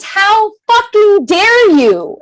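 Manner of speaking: high, slow, drawn-out outrage; indignant anger. Acted. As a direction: stretch each word in righteous indignation. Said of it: "I was mad".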